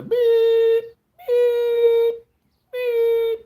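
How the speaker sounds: high, loud electronic beep; drawn-out shrieking drive-through beeps; imitated beep.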